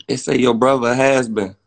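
usual speaking voice

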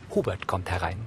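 ordinary talk, nothing marked

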